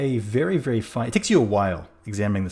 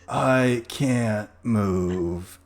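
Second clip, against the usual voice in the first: slow, hollow corpse voice; zombie; much slower than usual. Acted. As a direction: drag out each word like the dead.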